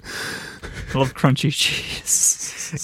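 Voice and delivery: whispered, slow mock devotion to crunchy cheese. Real, not acted. Joking to cohost about crunchy cheese, slow whisper. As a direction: breathe it as a slow secret love.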